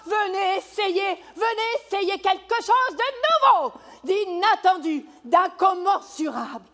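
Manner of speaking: loud high fairground barker; singsong market cry. Acted. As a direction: call the crowd in like a barker. Barker calling crowd in.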